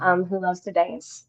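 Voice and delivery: slow, soft Southern drawl; Southern accent. Pretend. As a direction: let the drawl trail off quietly. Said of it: "In a Southern accent".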